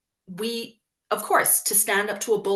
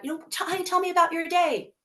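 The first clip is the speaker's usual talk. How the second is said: much higher, sing-song coaxing; prying mom; 10 semitones higher than usual. Retold or imitated. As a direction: sweet-talk the kid into sharing. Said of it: "our kid is like".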